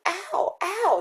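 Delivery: sharp high yelps of pain; ow, ow, like a sudden whack on the foot; crying out in pain. Acted. Ghost sister whacked on the foot, high yelps of pain. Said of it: "she cried".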